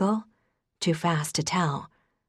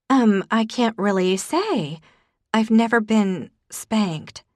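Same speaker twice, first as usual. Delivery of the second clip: flustered; 5 semitones higher, 5 dB louder, slower than usual. A narrator's reading. Flustered after spanking question.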